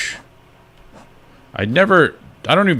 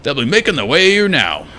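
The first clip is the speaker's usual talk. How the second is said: higher-pitched game character voice; acted grumpy character; put-on character accent; 7 semitones higher, 3 dB louder than usual. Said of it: "what accent I'm doing for this guy".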